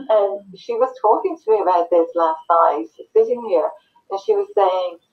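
ordinary delivery

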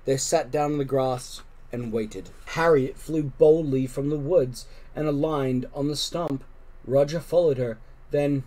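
ordinary delivery

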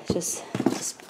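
breathy whisper to himself; excited hushed aside. Real, not acted. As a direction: whisper it under your breath. Asks viewers to bear with him.

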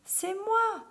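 high-voiced little girl. A narrator's reading. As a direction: pipe up high as a small girl. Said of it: "dit le petit chaperon rouge".

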